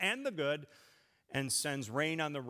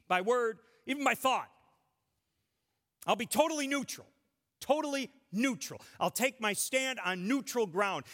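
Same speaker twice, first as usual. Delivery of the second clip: higher-pitched, emphatic mock protest; exasperated surrender; 4 semitones higher, faster than usual. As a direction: throw up your arms and insist, exasperated. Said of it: "we throw up our arms".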